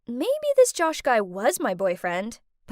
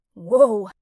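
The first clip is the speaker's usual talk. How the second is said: startled whoa; 4 semitones lower, 7 dB louder than usual. Acted. Waking dizzy in strange place.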